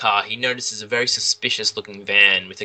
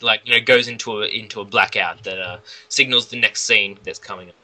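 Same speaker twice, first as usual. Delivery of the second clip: normal talking voice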